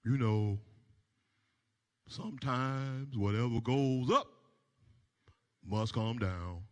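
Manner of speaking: low, slow, preachy friend voice; dismissive friend. Retold or imitated. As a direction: drone out the clichés like an unbothered buddy. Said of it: "he was like".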